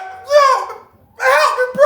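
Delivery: high desperate shout for help; desperate cry. Retold or imitated. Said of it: "He was like".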